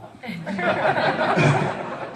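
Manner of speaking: loud laughter. Real, not acted. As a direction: burst of high, loud laughter. Laughing at Trevor hole joke.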